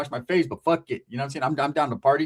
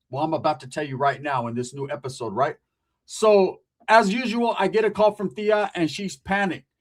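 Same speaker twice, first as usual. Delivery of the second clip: ordinary delivery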